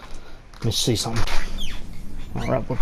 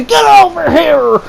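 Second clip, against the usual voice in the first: Scorpion; 11 semitones higher than usual. Retold or imitated. Stoned friend throwing pine cones from the tree, high mock battle cry. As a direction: yell it like a fighting game taunt. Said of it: "This motherfucker's like Scorpion".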